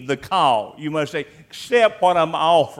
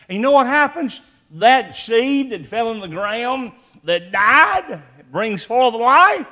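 rising preaching fervor; 6 semitones higher, 6 dB louder than usual. Real, not acted. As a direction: lift the congregation with each word. Preacher building to climax.

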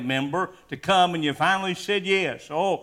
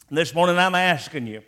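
normal talking voice

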